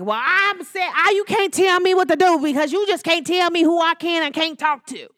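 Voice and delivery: ignorant mom. Retold or imitated. Mom defying her child about who she talks to, mock defiant voice. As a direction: play the stubborn mom talking back. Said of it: "Your mom was like".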